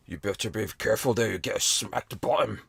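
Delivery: a rough, hammy Scottish purr, not quite roguish; Sean Connery impression. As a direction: slide through it like a bad Bond with a Scottish accent. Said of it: "coming from Sean Connery".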